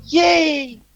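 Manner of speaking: high squeal of joy; a mock-giddy little celebration; excited cheer. Real, not acted. Cheering about going back to bed, high and giddy.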